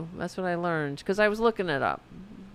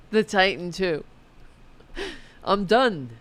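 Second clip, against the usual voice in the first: laughing; 4 semitones higher, 5 dB louder than usual. Real, not acted.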